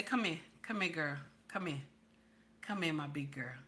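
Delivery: low, soft coaxing husband voice. Retold or imitated. Husband coaxing his upset wife, low and soft. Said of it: "my king will catch me and say".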